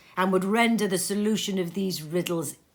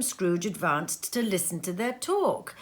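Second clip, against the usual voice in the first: usual speaking voice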